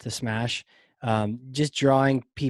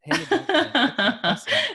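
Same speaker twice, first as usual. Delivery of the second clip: laughing amazement; 13 semitones higher, 3 dB louder than usual. Real, not acted. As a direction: laugh while marvelling at the catch.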